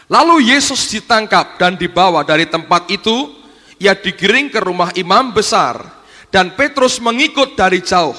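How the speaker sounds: loud, declamatory Bible reading; projected sermon reading voice; scripture reading. A narrator's reading. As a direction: read the passage out to a congregation. Reads scripture aloud.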